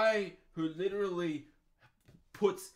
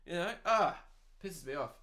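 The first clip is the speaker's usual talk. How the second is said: normal talking voice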